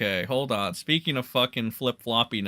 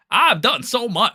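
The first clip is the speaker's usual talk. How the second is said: put-on hillbilly accent; 8 semitones higher, 5 dB louder than usual. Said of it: "I gotta give him the hillbilly accent".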